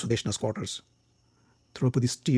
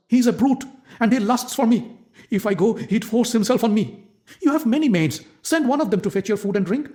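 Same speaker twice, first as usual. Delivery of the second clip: higher, urgent pleading; fearful pleading maid; 8 semitones higher than usual. Acted. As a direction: beg her not to send you. Maid begs to be spared.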